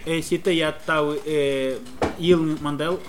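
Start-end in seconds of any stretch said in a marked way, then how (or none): none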